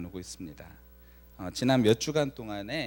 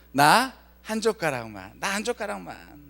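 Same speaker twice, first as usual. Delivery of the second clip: hammed-up plea for one bite; begging older brother; 8 semitones higher, 9 dB louder than usual. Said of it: "저희 형의 목소리가 들립니다".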